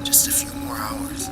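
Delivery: soft, unvoiced murmur into the phone; secretive whispered reply; hushed phone whisper. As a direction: whisper it so nobody overhears. Hiding call from parents.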